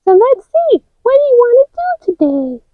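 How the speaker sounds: put-on high, bright-eyed toy girl voice; a high chirpy doll voice; high character voice. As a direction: play the cheerful little girl figure. Child playing with toy figures.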